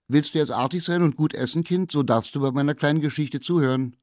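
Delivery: laut und hoch, schnell durch die Tür gerufen; rufende Mutter. Acted. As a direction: ruf es streng durch die geschlossene Tür. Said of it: "Da rief die Mutter". (Mutter ruft dem Kind vor der Tür laut zu)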